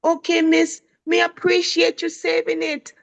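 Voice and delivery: lightly voiced second grader; little boy voice. Teacher reading the boy's message in his voice. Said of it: "he messaged back".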